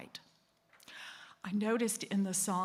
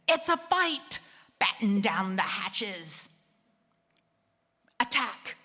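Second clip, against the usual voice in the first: put-on British accent, loud and urgent; 6 dB louder than usual. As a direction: shout the alarm in a British accent like a ship's officer. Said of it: "our nervous system has a British accent".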